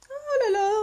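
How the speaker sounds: oh là là aigu, émerveillé; s'extasier devant un joli tampon; ravie. Real, not acted. Admire les tampons reçus. (émerveillement aigu)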